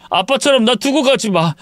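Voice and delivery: high, tearful begging on 가지 마; frightened son pleading, falling at the end; fearful plea. Acted. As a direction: beg not to be left, pitch way up. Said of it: "아들이 느끼는 공포심".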